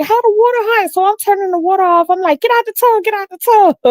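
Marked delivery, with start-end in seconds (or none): 0.0-0.9 high startled question; high shocked ask; alarmed question. Retold or imitated. Aunt feels hot bathwater. 2.4-3.8 high urgent repeated order; high, pressing get-out; urgent command. Retold or imitated. Pulling kids from hot bath.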